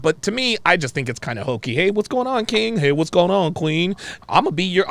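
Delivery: ordinary talk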